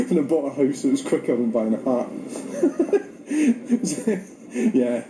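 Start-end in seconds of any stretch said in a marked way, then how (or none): 2.1-4.2 high laugh. Real, not acted. Laugh alone.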